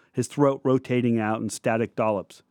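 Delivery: plain reading voice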